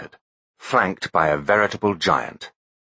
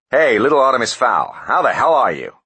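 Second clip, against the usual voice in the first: brash loud greeting; 7 semitones higher, 6 dB louder than usual. Acted.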